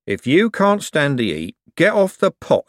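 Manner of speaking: senior clerk. Acted. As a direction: pronounce the saying emphatically. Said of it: "said a senior clerk".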